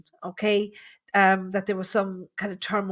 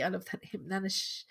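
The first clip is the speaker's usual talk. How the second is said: usual speaking voice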